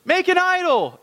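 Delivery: mocking the people demanding an idol; whiny Israelites. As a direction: squeal it like impatient complainers. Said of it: "they're like".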